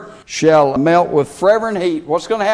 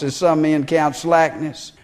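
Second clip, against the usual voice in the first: ordinary delivery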